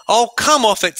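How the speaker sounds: voicing Edmund. Teacher reading tired Edmund, scoffing. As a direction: brush it off like a cross boy. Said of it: "said Edmund".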